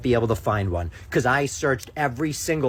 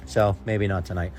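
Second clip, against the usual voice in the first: usual speaking voice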